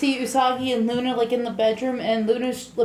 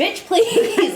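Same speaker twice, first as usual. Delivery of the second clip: sassy retort; 6 semitones higher than usual. Retold or imitated. Sassy retort while laughing.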